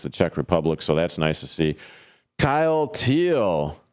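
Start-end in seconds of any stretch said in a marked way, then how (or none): 2.4-3.8 announcer name call. Acted.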